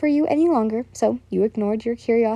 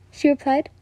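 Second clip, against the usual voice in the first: ordinary delivery, nothing marked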